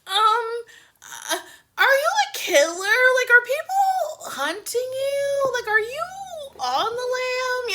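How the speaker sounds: put-on high, hesitant childlike questioning; high kid voice piling on worried questions; nervous little girl. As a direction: stammer it out like a frightened kid. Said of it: "And she's like".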